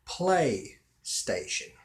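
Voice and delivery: PlayStation said slowly in two parts; stretched, deliberate "Play... Station"; slow word. Shown as a demonstration. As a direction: draw out PLAY, then STATION. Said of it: "slowly and think about the word".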